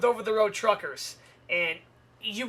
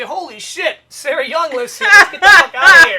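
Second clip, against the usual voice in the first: burglars' hammed-up panic, building louder; mock alarm; 5 semitones higher, 13 dB louder than usual. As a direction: build to a loud, comic get-away cry. Acts out burglars panicking at picture.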